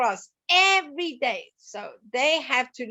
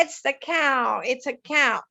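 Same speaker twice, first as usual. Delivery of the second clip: normal talking voice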